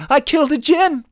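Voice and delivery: very high, loud panicked cry; rising fear. A narrator's reading. Young soldier panicking, high fearful cry. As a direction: shriek it in horror. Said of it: "his voice rising in fear".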